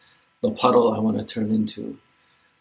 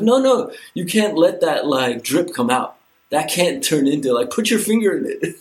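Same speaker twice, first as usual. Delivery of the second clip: mock panicked defender; 6 semitones higher, 6 dB louder than usual. Mocks defenders pushing back gates.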